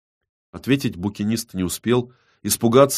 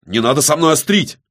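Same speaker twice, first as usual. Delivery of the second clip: high, loud, strained outburst; cracking-voice shout; 7 semitones higher, 6 dB louder than usual. A narrator's reading. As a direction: cry out as your nerves give way. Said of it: "срывающимся голосом прокричал Виссарион".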